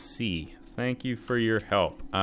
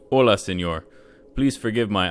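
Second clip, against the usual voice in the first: put-on Spanish accent; 7 dB louder than usual. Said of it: "I'm not gonna do an accent".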